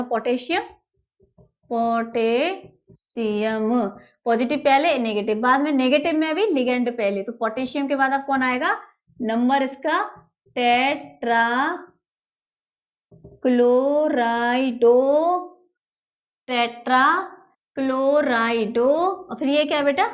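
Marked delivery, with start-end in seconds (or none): 1.7-3.7 potassium stretched out syllable by syllable; slow dictation. Shown as a demonstration. Repeats it slowly while writing. 10.6-18.9 each syllable stretched, with gaps; slow dictation. Shown as a demonstration. Dictates the name while writing.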